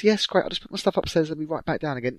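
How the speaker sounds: high, quick brush-off; rushed evasive teen. Retold or imitated. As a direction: hurry past the question. Voicing his younger self, evasive.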